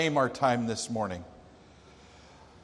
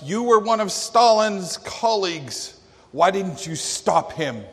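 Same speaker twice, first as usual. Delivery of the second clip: raised, louder heckler calling out from the crowd; heckler's yell; 6 semitones higher, 5 dB louder than usual. Retold or imitated. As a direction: yell it out like a heckler interrupting a speech. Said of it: "The heckler yelled out".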